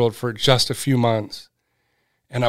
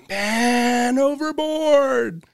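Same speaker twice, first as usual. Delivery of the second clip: high, slow, drawn-out mock call from the distance; imitated far-off cry; 11 semitones higher, 4 dB quieter, much slower than usual. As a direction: stretch it out like a faint shout across the water. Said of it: "you just hear people screaming".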